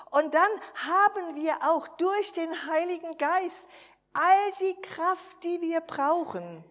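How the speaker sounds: fervent preaching. Acted. Preacher rousing her congregation, fervent preaching. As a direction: proclaim it to the congregation with joy.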